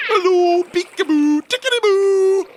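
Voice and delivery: very high, loud, playful sing-song; friendly whale voice. A narrator's reading. Wilhelmina coaxing the children out, high playful sing-song. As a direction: coax them out gently and playfully. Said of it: "trying to sound as friendly".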